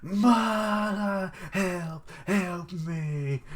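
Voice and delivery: high, panicky plea; mock cry for help. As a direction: squeal for help, hammed up. Voicing a guard calling for help.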